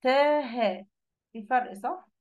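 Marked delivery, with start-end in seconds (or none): 0.0-0.9 "تاه" with a thin, light t; light unemphatic ta. Shown as a demonstration. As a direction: say "تاه" with a plain front t, no fullness. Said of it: "أنا ممكن أقول إيه".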